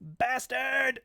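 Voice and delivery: high furious yell; angry shout. Acted. Thug shouting at DJ after the punch, furious.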